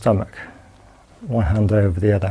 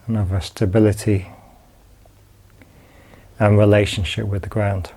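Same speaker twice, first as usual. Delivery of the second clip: calm, unhurried body-scan guidance with long pauses; guided meditation. Acted. Teacher guiding students lying down through a body scan, calm meditation voice.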